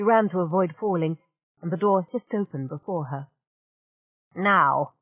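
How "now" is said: snarl. Acted. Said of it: "Belazir snarled".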